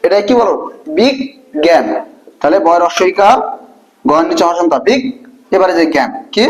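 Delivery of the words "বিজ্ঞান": বিজ্ঞান broken into "big" and "gæn"; standard pronunciation. Shown as a demonstration. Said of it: "সেই উচ্চারণটা লিখতে হবে।".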